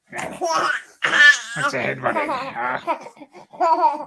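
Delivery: high squeaky laugh through the words; real giggling while asking. Real, not acted. Dad laughing at kid's headbutt, high and giggling.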